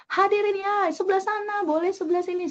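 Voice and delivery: high, rapid hype emcee; quick-fire crowd-rousing patter; very fast non-formal MC. Shown as a demonstration. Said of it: "Itu temponya cepet banget".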